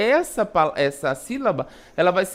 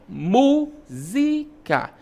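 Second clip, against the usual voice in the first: "Música" very slow in separate syllables, "Mú" high and strong; stress on first syllable; 5 semitones higher, much slower than usual. Shown as a demonstration. Teacher showing an antepenultimate stress, "Música" slowly split, stressed on "Mú". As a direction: lean on "Mú", then space out the rest of "Música". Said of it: "mu é pronunciado com mais".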